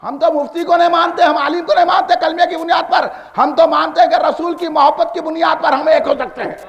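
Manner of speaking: ordinary talk, nothing marked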